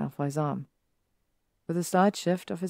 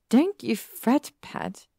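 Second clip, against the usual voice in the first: teasing coo; 8 semitones higher, 4 dB louder, slower than usual. Acted. Pansy teasing Harry.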